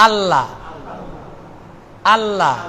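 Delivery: আল্লাহ with a held double l, no n; doubled l. Shown as a demonstration. Teacher demonstrates tashdid on lam, doubled l in আল্লাহ. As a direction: press the l in আল্লাহ without any nasal hum. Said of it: "লামের উপরে তাসদিদ হবে".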